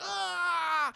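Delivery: high, drawn-out wail of pain; mock pain wail. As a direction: wail as if an arm just broke. John's arm snaps.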